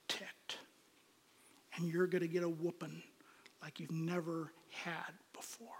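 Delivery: a soft, dropping mock threat from an angry dad; imitating a ticked-off father hushing out a threat; mock menacing threat. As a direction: let the threat sink to a hush. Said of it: "Dad's ticked".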